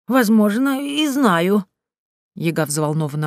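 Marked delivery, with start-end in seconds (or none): 0.1-1.7 high-pitched, cagey witch; evasive Yaga. A narrator's reading. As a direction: admit it evasively in a raised pitch. Yaga answers evasively, flustered. (уклончиво, высоким голосом Яги)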